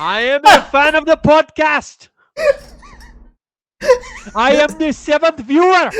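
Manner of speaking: creepy fan voice. Acted.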